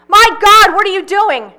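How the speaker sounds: a mother's sudden shocked shout; yelling. A narrator's reading. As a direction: scream it out in alarm. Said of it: "Pauline starts to yell".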